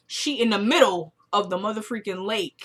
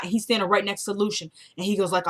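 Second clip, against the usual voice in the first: normal talking voice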